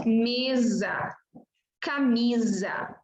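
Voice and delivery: "mesa" and "camisa" with a long buzzed z for the S. Shown as a demonstration. Teacher shows S between vowels, buzzed Z. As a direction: lengthen the voiced z inside "mesa" and "camisa". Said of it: "S entre vogais, então você diz".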